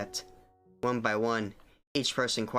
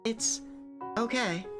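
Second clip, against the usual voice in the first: bad acting; 3 semitones higher than usual. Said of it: "I apologize for my terrible voice acting".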